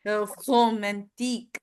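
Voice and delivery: The romantique with a guttural French R; French accent. Shown as a demonstration. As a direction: gargle the R in romantique. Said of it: "So try going".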